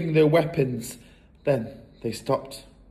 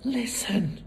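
soft, higher, urgent near-whisper; hushed urgency; 10 semitones higher, 6 dB quieter than usual. A narrator's reading. Matilda makes the family listen, hushed. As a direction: hush them to make them listen.

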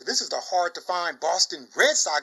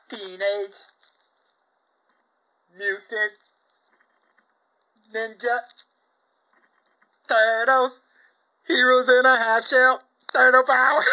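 higher, louder hype announcer build; 6 semitones higher, 6 dB louder, slower than usual. Pretend. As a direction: call out each word like a trailer voice. Announcing comic titles dramatically.

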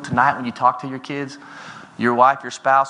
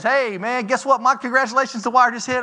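excited, much higher-pitched retold phone call; excited banker; 11 semitones higher than usual. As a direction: break the big news like a thrilled banker. Banker calls with big money news.

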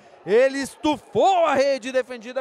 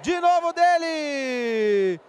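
louder, higher goal scream; goal call; 4 semitones higher, 4 dB louder, slower than usual. Real, not acted. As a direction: erupt into a goal shout.

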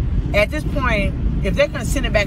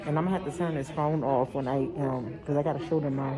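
low, very soft update in a public place; hushed, aggravated; 4 semitones lower, 10 dB quieter than usual. Real, not acted. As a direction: keep your voice down while reporting your annoyance. Keeping voice down inside post office.

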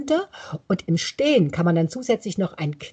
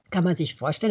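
ordinary delivery

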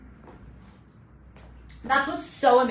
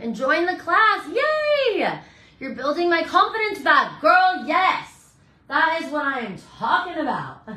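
loud, high whoop of approval; excited cheer; 6 semitones higher, 6 dB louder than usual. Real, not acted. Teacher cheering a viewer who joins the class, excited. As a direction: cheer her on loudly, pitch leaping on the yay. Celebrating a viewer's encouraging comment.

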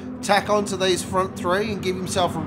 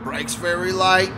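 normal talking voice

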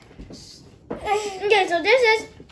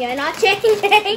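laughing; 5 semitones higher, 8 dB louder than usual. Real, not acted. Teasing sister eating.

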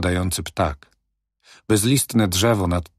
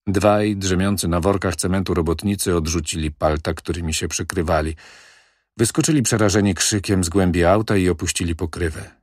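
ordinary delivery

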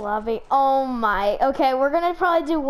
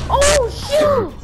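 much higher, louder sudden alarm; startled yelp; 12 semitones higher, 6 dB louder than usual. Real, not acted. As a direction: shout caught off guard. Sudden enemy attack.

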